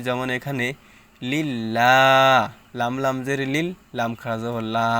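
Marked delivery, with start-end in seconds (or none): 1.2-2.4 loud "লিল্লাহ" with long open a; light lam. Shown as a demonstration. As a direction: draw out "লিল্লাহ" on a thin la. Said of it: "লা উচ্চারণ করতে হবে". ("লিল্লাহ"-তে পাতলা লামে লম্বা টান)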